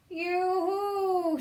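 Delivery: high, loud sing-song call; shouted yoo-hoo. Acted. Witch calling out across the meadow, high and loud. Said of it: "she shouted".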